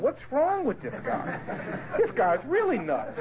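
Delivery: laughing disbelief. Retold or imitated. Baffled by a weeping man.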